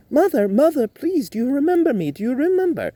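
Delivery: high, loud pleading; pleading son. Acted. Timothy pleading with his mother to remember him, high. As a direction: beg her to remember you.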